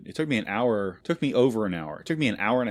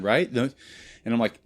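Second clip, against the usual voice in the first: ordinary talk, nothing marked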